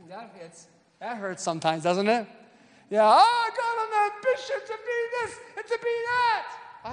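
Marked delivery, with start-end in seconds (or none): 3.0-6.5 high mocking sing-song of a career chaser; mock ambitious voice. Preacher mocking ambitious people in a high put-on voice. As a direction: parody someone bragging about ambitions.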